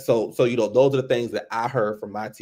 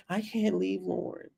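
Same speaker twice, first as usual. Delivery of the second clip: pleading mother; 4 semitones higher, 10 dB quieter than usual. Retold or imitated. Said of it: "Kendra's still sitting there like".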